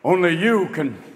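mock mascot slogan, dropped in pitch; Smokey the Bear. Smokey the Bear losing his slogan, low stoned voice. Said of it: "Even Smokey the Bear is going".

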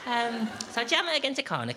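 put-on high, sing-song voice like talking to a small child; lightly voiced fussy housemate; patronising explainer voice. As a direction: talk down to them as if to a toddler. Continues fussy instruction.

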